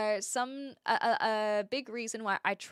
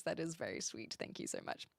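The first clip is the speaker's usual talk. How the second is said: ordinary talk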